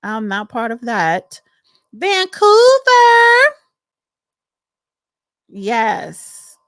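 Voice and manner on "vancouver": loud, high, stretched-out holler; whooping city shout-out; roll-call shout. Real, not acted. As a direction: belt out the city name, drawn long. Calling out a viewer's city.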